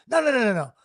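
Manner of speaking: ordinary delivery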